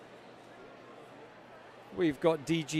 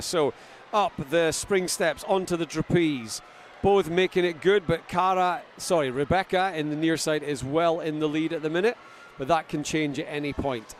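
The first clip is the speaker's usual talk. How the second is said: lively play-by-play, pushing louder; live race commentary; 3 semitones higher, 5 dB louder than usual. Acted. Commentator calling the race to the crowd, lively play-by-play. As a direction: call the ninja race as it happens.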